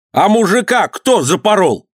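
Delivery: high, accusing cry; angry accusation. A narrator's reading. Governor accuses Ivan in quarrel. (обвинение высоким голосом)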